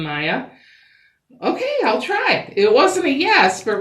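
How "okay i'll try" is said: cheerful high. Acted. Mister Green agreeing.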